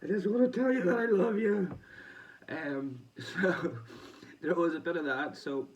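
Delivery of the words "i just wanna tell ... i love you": high, loud mock-tearful gushing; imitating an emotional boxer, pitched high and loud; imitating crying boxer. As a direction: ham up a weepy declaration of love. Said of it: "Berlanga was crying and all".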